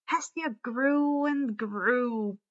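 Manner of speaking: usual speaking voice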